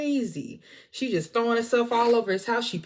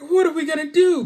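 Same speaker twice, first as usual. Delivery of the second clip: mock panic; 9 semitones higher, 9 dB louder than usual.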